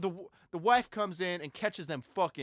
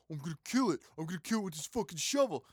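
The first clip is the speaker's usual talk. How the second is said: mock vengeful heroine; 4 dB quieter, faster than usual. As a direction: lightly act a determined avenger. Acts heroine hunting creature with shovel.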